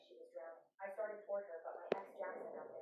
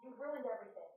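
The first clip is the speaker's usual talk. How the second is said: higher-pitched, restrained mock yell; narrated scream; 8 semitones higher than usual. A narrator's reading. Father dragged away in cuffs, restrained scream. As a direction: read a scream with raised pitch, little extra volume. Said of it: "he twisted around screaming".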